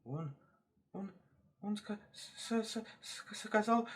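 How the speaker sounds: soft, high, stuttering hare; tiny, halting, timid voice; frightened stammer. Acted. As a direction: falter on every word before getting it out. Terrified hare stutters out his answer.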